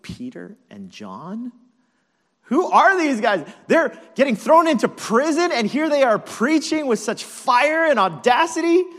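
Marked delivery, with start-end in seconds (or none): 2.4-8.8 high, loud incredulous outburst; hammed-up loud disbelief; astonished onlookers. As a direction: exclaim in amazement at their nerve.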